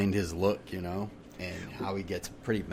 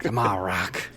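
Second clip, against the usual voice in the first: gruff trainer voice, raised; Mickey from Rocky; 5 dB louder, slower than usual. Acted. Co-host joining in the boxing-film bit, gruff trainer impression. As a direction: urge on the boxer like his old coach. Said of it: "I said Mickey from Rocky".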